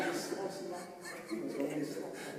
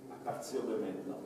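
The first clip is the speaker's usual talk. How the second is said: hushed hypnotic suggestion; 7 semitones lower, 4 dB quieter than usual. Acted. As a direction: plant the suggestion gently.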